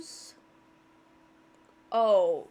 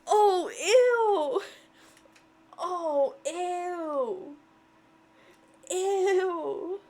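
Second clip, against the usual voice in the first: loud, high grossed-out squeals; loud and higher, real disgust; 6 semitones higher, 6 dB louder, much slower than usual. Real, not acted. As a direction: recoil in real disgust with a laugh. Grossed out by game character.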